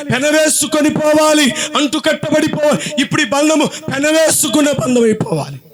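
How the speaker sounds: high, urgent sermon call; fervent preaching. Acted. Preacher urging the congregation, high fervent call. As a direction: drive the plea up and out.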